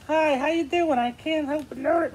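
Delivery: high silly puppet character. Acted. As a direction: pitch it way up like a puppet. Puppet greets another puppet.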